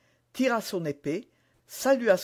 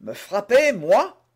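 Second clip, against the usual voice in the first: louder, disbelieving question; a touch higher, mocking disbelief; astonished scorn; 4 semitones higher, 7 dB louder, slower than usual. A narrator's reading. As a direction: scoff in surprise and contempt. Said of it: "autant d'étonnement que de mépris".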